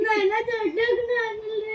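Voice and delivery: real high-pitched tearful wail; crying. Real, not acted. Daughter answering her consoling parents, crying. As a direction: cry the words out, pitched high. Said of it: "ಅಳ್ಬೇಡ ಗಪ್ಪು".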